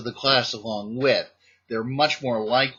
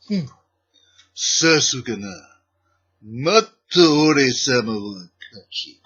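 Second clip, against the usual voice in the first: Japanese villain voice; 6 dB louder than usual. Acted. Teacher gloating over the class after getting every student out, in a smug Japanese villain voice. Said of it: "my best Japanese villain voice".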